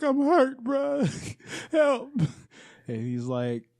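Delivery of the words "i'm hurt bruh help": much higher, louder pleading voice; pleading stall guy. Retold or imitated. Stranger in the stall begging him for help, high and pleading. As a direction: squeak it out like a desperate stranger.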